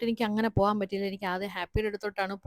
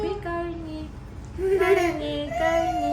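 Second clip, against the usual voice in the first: much higher, louder sing-song comfort; 12 semitones higher, 5 dB louder, slower than usual. Real, not acted. Mother comforts the crying little one in a high, loud coo.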